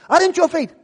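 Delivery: high, loud threatening question; police shout. Retold or imitated. Officer shouting at father with gun. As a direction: bark the threat.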